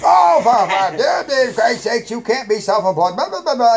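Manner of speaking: loud, high, blustering mock tirade; sputtering retold outrage; imitated angry principal. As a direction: rant like an exasperated principal, trailing into blah-blah. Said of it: "he didn't take that too kindly".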